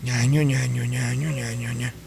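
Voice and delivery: low, soft nasal mimic of off-key howling; ña ño mock wailing; mock bad singing. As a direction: parody the screeching.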